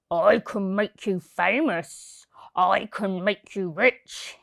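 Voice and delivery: low, slow coaxing showman voice; scheming circus owner. A narrator's reading. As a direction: lure her in like a sly ringmaster, deep and unhurried. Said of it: "He said".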